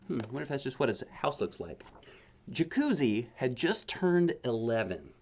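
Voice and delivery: usual speaking voice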